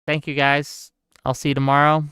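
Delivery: normal talking voice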